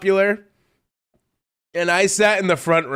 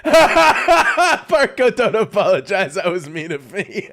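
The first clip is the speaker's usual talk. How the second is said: laughing protest; 2 semitones higher, 5 dB louder, slower than usual. Real, not acted. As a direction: talk through real laughter. Cracking up over the dead dad joke.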